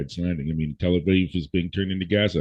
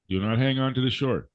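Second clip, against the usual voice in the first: usual speaking voice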